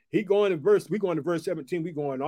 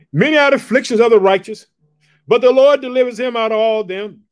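much louder, higher proclamation; loud scripture proclamation; 5 semitones higher, 12 dB louder than usual. Acted. Preacher booming the psalm to the persecuted church. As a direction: boom the verse out.

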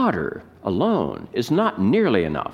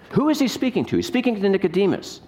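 normal talking voice

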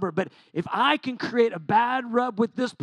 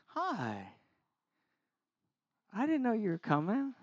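real surprise; 8 dB quieter than usual. Real, not acted. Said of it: "This is my wife".

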